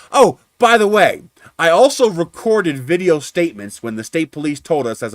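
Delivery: loud, high, pointed retold reveal; spring it on them as a gotcha; petty and nasty. Driver revealing recorded statements to adjuster, petty and nasty. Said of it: "I decided to get petty and get nasty".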